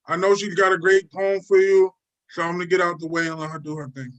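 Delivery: ordinary delivery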